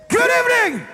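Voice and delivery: calling out. Real, not acted. Frontman greets crowd.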